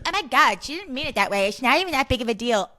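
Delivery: high, rushed mock defensive voice.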